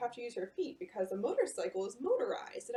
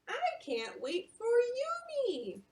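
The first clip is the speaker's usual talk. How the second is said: high, slow longing voice; grandma's high, slow wistful line; eager grandma; 12 semitones higher, slower than usual. Acted. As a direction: ache to see her granddaughter. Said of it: "says Yumi".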